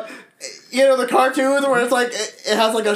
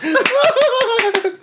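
laughing squeal; 13 semitones higher than usual. Real, not acted. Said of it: "Did you say chicken nuggies".

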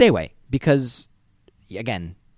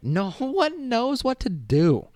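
slow, high-pitched comic wail; high drawn-out wail; 12 semitones higher, much slower than usual. Acted. Repeating the retort to the student, high wail. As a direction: stretch every word up high.

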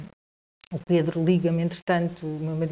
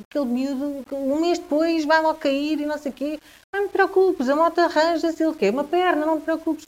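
brushing off worry in a raised, lightly acted voice; Pedro's reassurance; 9 semitones higher than usual. Pedro reassuring Diana about the moto, raised and brushing off. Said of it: "E ele diz".

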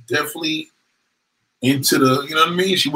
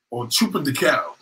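normal talking voice

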